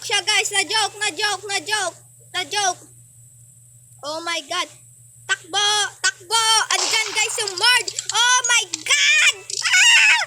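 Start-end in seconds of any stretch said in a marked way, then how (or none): 5.3-10.2 slow panicked cry into a high shriek; scared scream. Real, not acted. Gamer spotting the murderer, screaming in real fright.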